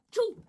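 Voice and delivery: sharp spoken sneeze; a quick acted sneeze. Gerald sneezes mid sentence.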